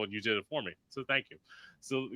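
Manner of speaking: ordinary delivery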